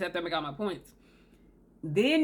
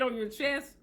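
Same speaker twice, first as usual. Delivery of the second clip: ordinary talk, nothing marked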